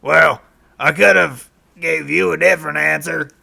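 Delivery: ordinary talk, nothing marked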